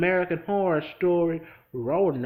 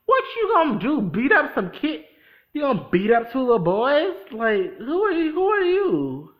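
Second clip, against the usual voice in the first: loud, higher scolding challenge; talking back at the character on screen, indignant; indignant retort; 7 semitones higher, 6 dB louder than usual. Retold or imitated. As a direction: call him out in disbelief. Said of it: "I'm like".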